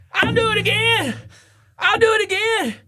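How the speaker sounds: very high, strained captain voice; squeaky, proud insistence; stubborn mock captain.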